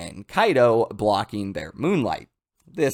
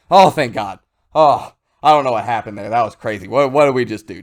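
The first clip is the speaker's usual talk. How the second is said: relieved excited Momo; 6 dB louder than usual. Retold or imitated.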